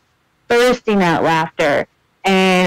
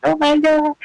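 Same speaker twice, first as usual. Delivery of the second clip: higher fan squeal, star-struck; freaking out; 7 semitones higher than usual. Retold or imitated. Fan meeting her favourite actor, freaking out. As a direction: gush it out high, overwhelmed. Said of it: "you just start freaking out".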